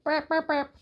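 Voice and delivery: high, squeaky toy robot. Pretend. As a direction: chirp it like a little robot. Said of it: "This looks like a robot".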